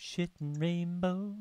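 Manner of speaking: drawn-out slow delivery; slow savoring. Pretend. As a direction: stretch each word out. Savours the phrase.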